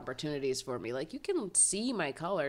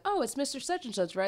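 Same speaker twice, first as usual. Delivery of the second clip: higher-pitched, tentative greeting; acted-out eager guess, pitched up; hopeful guess; 9 semitones higher than usual. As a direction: try the name, hoping it's right. Acting out greeting the wrong member.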